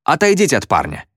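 high-pitched order to a crowd; understated holler; mild yell. A narrator's reading. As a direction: call out to make them back off. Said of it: "завопил Мо толпе".

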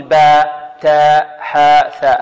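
"باء، تاء، حاء، ثاء" thin and light, drawn out; light letters. Shown as a demonstration. Teacher lists examples for students, light thin letters. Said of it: "كلها مرققة".

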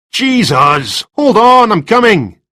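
loud, high exasperated shout; calling out. Acted. Resident to caller at the door, irritated high shout. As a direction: holler it to someone at the front door.

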